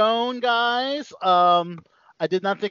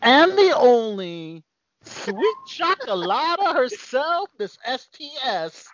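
higher, slow hype introduction; announcer voice; 7 semitones higher, slower than usual. Acted. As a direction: draw out the name like a ring announcer. Host hypes Sabrina's introduction.

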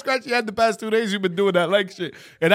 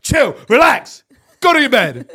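annoyed dog owner; 5 semitones higher, 6 dB louder than usual. Retold or imitated. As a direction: imitate her ordering the dog off. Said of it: "she gets tight as fuck".

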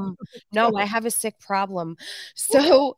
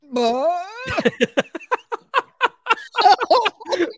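long, high, loud burst of real laughter; helpless belly laugh that keeps going; uncontrollable laughing fit; 9 semitones higher, 5 dB louder than usual. Real, not acted. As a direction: completely lose it laughing. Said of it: "That one hit me funny".

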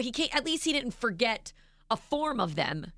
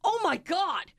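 mock shock; 8 semitones higher, slower than usual. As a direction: act out the husband's shock at the chicken. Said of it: "and he goes".

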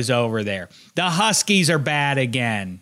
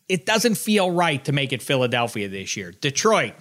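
ordinary talk

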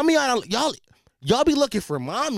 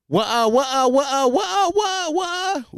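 chanted ad-lib climbing higher and higher; 6 semitones higher than usual. Acted. Man mimicking a rapper's catchphrase, ever higher. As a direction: repeat it in a rising, high put-on voice. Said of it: "Young Miami shit".

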